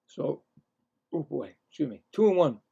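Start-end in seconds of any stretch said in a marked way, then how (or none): 2.1-2.6 raised, louder live call of the action; excited baseball commentary; play-by-play. Acted. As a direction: call the hit like a radio announcer. Calls the count.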